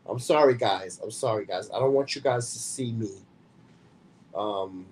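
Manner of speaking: ordinary talk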